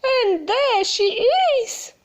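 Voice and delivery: little bear pointing her out; high squeaky cub voice; squeaky Baby Bear. Acted. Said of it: "high, squeaky voice".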